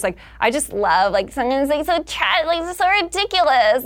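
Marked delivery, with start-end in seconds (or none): 1.1-3.8 affected, high-pitched pop starlet; sexy affected tone, pitched up. Acted. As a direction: talk like a vapid pop star. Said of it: "the kind of pop star voice".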